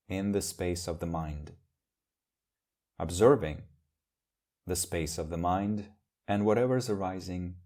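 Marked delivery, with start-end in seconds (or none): none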